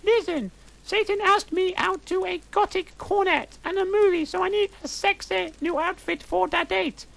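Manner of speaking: put-on squeaky high goth girl, breathless gush; shrill up in the head like a teen diva; high valley girl voice. Narrator gushing to friends in a high valley girl voice.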